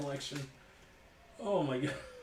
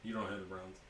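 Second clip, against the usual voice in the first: ordinary delivery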